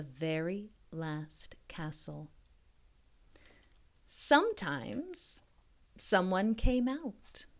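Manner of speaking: slow soft whisper to close; hushed ending. A narrator's reading. Closing the picture book for young listeners, soft hushed ending. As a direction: let the story settle gently.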